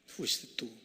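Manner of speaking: hushed whisper; preacher confronting the listener in a whisper; whispered accusation. Acted. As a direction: breathe it straight at them.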